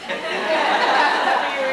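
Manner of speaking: loud, high, delighted laugh; a short bright real laugh; burst of laughter. Real, not acted. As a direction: laugh out at your own joke. Audience joke about the loo.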